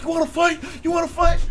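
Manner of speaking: hammed-up jittery fighter; mock taunt. Coworker hopping around taunting.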